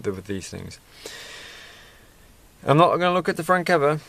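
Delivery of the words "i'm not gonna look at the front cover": higher, faster, firm; higher, quick mock-stern self-warning; playful resolve. Real, not acted. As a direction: talk yourself out of peeking. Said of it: "resolving not to peek".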